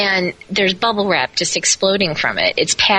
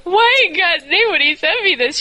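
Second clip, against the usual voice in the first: retold crying complaint; 9 semitones higher than usual. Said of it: "I'm, like, crying at this point".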